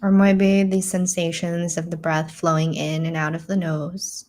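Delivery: calm, measured meditation voice with long pauses; guided meditation. Real, not acted. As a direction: guide them gently inward, leaving space to breathe.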